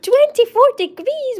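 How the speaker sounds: checking a viewer's hot weather, astonished cry; amazed. Real, not acted. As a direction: gasp it out in disbelief.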